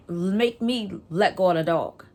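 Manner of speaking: usual speaking voice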